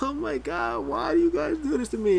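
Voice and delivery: high-pitched, amused mock exclamation; imitating amused laughing. Imitating Randy Orton just laughing at the prank, high and amused. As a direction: pitch it way up, as if cracking up. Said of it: "Randy Orton's just laughing".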